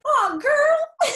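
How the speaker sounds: high, loud cry of being moved; tearful exclamation. Real, not acted. Friend moved by the other's kind words, tearful exclamation.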